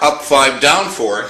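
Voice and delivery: usual speaking voice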